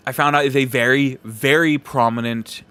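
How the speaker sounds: ordinary talk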